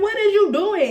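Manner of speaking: high, loud rising question; sudden alarmed curiosity; startled question. Retold or imitated. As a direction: jump on him with it. He reaches toward the door.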